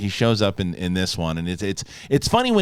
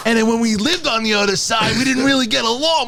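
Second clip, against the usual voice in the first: mock New York relative; 9 semitones higher than usual. Voices New Yorker in California.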